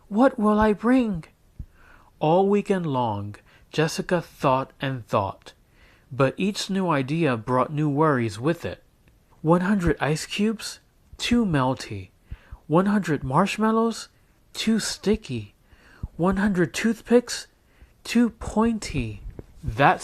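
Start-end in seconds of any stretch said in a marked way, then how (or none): 0.1-1.2 put-on high, fretful little girl asking herself; a child fretting to herself; worried girl voice. A narrator's reading. Jessica fretting alone: higher worried girl voice. 9.4-19.2 lightly voiced girl, weighing each idea; a hint of a worried child musing; fretful girl. A narrator's reading. Jessica sorting ideas: lightly voiced fretful girl.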